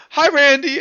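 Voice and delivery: bright, loud, high hello; cheery greeting. Acted. Kilroy calls out to Randy in the water, a cheerful high greeting. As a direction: call out happily to a friend.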